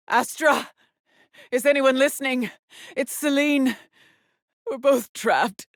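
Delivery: high-pitched plea for someone to answer; desperate calling out. Acted. Trapped woman calling for anyone listening, desperate. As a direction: call out anxiously into the emptiness.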